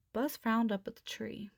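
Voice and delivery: ordinary delivery, nothing marked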